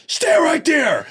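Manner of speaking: high, slow, warning command; stern order. Retold or imitated. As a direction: lay down the law. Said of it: "She went".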